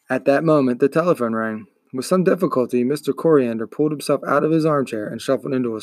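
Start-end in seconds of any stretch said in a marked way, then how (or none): none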